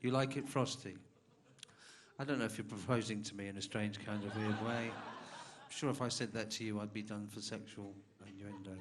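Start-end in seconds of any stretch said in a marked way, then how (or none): none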